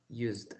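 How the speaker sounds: USED in one syllable, "yoozd"; correct pronunciation. Shown as a demonstration. Teacher repeating the correction, "used" said softly as one syllable "yoozd". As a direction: softly model "used" with a silent E. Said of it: "pero es used".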